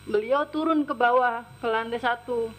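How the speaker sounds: usual speaking voice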